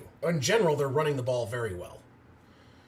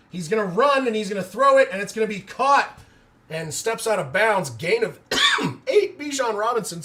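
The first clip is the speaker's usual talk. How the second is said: building play-by-play; 4 semitones higher, 6 dB louder than usual. Acted. Streamer calling the scramble live, from hushed to loud excited play-by-play. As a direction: build the call as the scramble unfolds.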